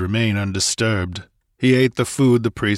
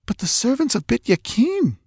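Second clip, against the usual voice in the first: high, frightened half-whisper; fearful whisper. Acted. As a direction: breathe it out in fear, pitched high. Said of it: "she whispered fearfully".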